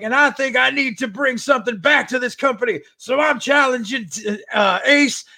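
mock challenge shout; hammed-up wrestling promo. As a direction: call out the champs like an old heel manager. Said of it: "And he's like".

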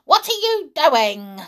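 put-on high, loud demanding old woman; shrill Grandma voice. A narrator's reading. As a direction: snap the question shrilly. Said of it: "came the shrill voice".

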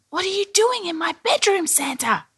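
very high talking-bathtub voice; Billy's eager little voice. A narrator's reading. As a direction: ask like a small, wide-eyed character. Billy the bathtub asks Santa.

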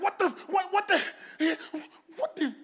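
high, loud, halting exclamation; mock outburst. Preacher teasing congregation with an almost-curse, mock outburst. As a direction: play at nearly swearing, breaking off each time. Mock near-swearing before a pious twist.

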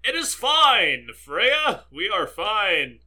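big, drawn-out actorly reassurance; Bruce Campbell. Acted. Reassuring cohost Freya after they both spaced out, hammed-up actor voice. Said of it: "I gotta sound like Bruce Campbell".

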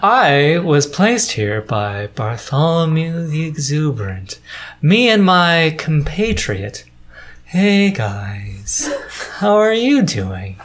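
drawn-out gargoyle voice with long pauses; lilting, measured stone guardian. Acted. Game master introduces the gargoyle and compatriot, measured character voice.

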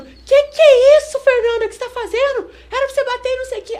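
high-pitched, loud mock scolding from the corner; imitated yelling. Cornerman yelling at Fernanda mid-fight, imitated exasperated shouting. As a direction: impersonate a cornerman shrieking in disbelief. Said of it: "o carioca gritando".

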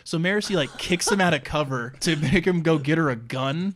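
normal talking voice

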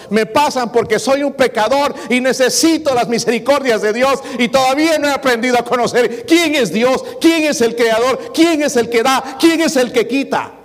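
voz de púlpito, alta y enfática; predicando con fuerza. Acted. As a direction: proclama cada frase a la congregación. Preaches at full force. (predicador exhortando a los hermanos con fuerza)

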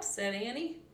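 usual speaking voice

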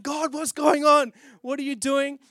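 high, loud, slow plea; pleading cry. Retold or imitated. Pleading with God during prayer and fasting. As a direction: cry out slowly to heaven.

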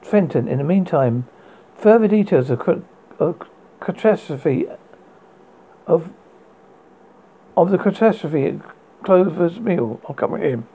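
put-on old radio announcer reading news; urgent bulletin read on air.

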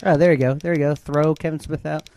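loud heckling. Real, not acted. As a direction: shout it like a heckler. Mock cheering at Kevin Smith.

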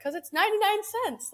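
high-pitched, giddy self-defence; excited justification. Retold or imitated. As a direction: retell it high, as if it's obvious and thrilling. Retold excited answer to mom.

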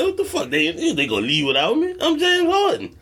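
high, outraged imitation of Harden; indignant star. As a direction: squeal it like an offended star. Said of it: "he was probably saying".

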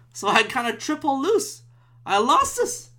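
ordinary talk

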